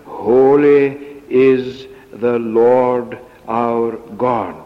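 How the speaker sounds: "Holy" first and weighty, slow throughout; stress on Holy. Shown as a demonstration. Preacher quoting verse nine, slow weighty stress on "Holy". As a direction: draw out "Holy", then proceed slowly to the close. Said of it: "The emphasis is on the word holy".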